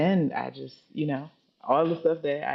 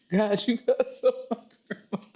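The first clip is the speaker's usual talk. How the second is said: high-pitched plea through laughter; imitating an earnest prayer, amused and higher; laughing mock prayer; 7 semitones higher than usual. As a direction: plead to God, half laughing. Repeats childhood prayer while laughing.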